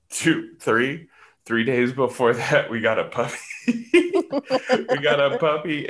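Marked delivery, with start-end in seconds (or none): 3.7-5.9 slow, laughing through the words. Real, not acted. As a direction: laugh while repeating it. Repeats the news laughing.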